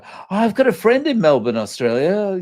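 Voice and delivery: high, eager mock delight; excited retold exclamation. New acquaintance to visitor from Melbourne, excited discovery. Imitating excited people met in Japan.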